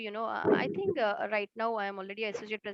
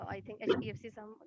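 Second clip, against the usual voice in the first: usual speaking voice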